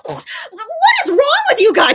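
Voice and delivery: high, loud, slow exasperated shout; exasperated yell. Retold or imitated. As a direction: yell at the crowd in disbelief. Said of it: "I'm just like".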